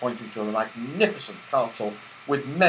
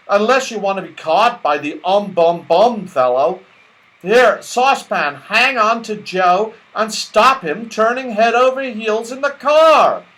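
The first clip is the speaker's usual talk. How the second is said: high, louder commanding voice; Moonface, bossy; 9 semitones higher, 6 dB louder than usual. Acted. Moonface ordering Saucepan to hold Joe, loud and bossy. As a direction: order them about urgently.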